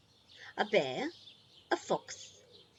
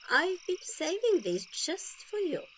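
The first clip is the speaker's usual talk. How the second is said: higher, louder fond Grandma; Grandma's voice; 10 semitones higher, 6 dB louder than usual. Acted. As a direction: offer a gift with delight. Grandma voiced in read story.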